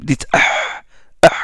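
ordinary talk

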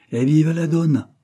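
high, loud cheer; exclaiming. A narrator's reading. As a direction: cry it out as a hearty toast. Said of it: "S'écria le Fulminante".

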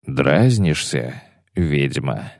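low and slow threat; menacing slow growl. Acted. Bonart threatens, approaching. (тихая медленная угроза)

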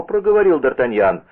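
usual speaking voice

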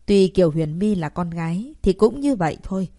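plain reading voice

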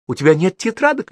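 high-pitched rhetorical question; exasperated mother interrogating; shouted question. A narrator's reading. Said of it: "выкрикивая всякие вопросы".

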